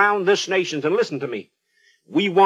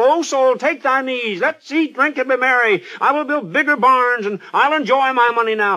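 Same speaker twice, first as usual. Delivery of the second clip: high, gleeful rich man; gloating rich man; 5 semitones higher than usual. Retold or imitated. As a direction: gloat over your wealth. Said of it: "he said to himself".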